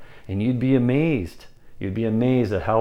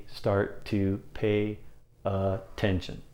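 slow, spaced words trailing softer; deliberate, halting pace; slowed rhythm; 3 semitones lower, 9 dB quieter, much slower than usual. Shown as a demonstration. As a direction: draw each word out for attention.

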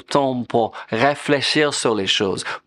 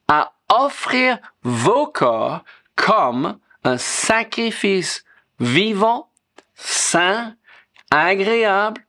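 slow scripture reading; 6 semitones higher, much slower than usual. Acted. Reading scripture slowly, raised.

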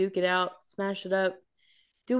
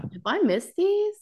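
puzzled; 10 semitones higher, slower than usual. Retold or imitated. Own thought, retold.

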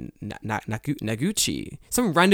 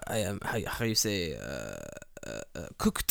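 mock French accent, much lower and softer; snooty French accent; 7 semitones lower, 9 dB quieter than usual. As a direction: hunt for the word like a pompous Frenchman. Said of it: "my snooty ... Japanese person".

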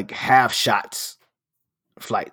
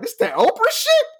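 very high, incredulous echo; can't believe what he just heard; squeaky high disbelieving repeat; amazed question. Retold or imitated. Repeating bartender's claim, amazed.

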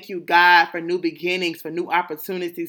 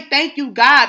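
fervent thanks; 6 semitones higher, 7 dB louder than usual. Shown as a demonstration. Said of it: "still say".

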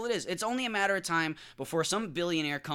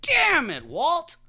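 mock exasperation; 12 semitones higher, 3 dB louder, much slower than usual. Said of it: "I was like".

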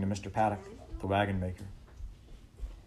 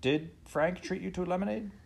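high, probing question; questioning father; 10 semitones higher than usual. A narrator's reading. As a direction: probe gently like a father.

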